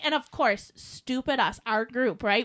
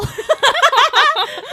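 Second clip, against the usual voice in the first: 1 high laugh; 11 semitones higher, 9 dB louder than usual. Real, not acted. Laughs at Woody doll.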